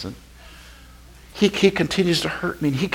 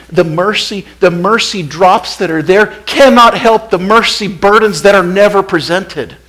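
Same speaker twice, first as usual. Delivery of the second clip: raised, loud, driving sermon climax; preaching at full force; 7 semitones higher, 8 dB louder than usual. Real, not acted. Preacher pressing the congregation to bring their burdens, loud. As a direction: hammer the point home.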